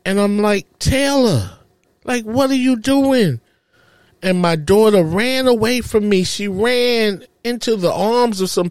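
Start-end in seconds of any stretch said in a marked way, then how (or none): 0.8-3.4 high-pitched, disbelieving appeal; retold dismayed plea. Father retelling his appeal to his daughter pulling away, dismayed. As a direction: plead in dismay, pitched up without shouting.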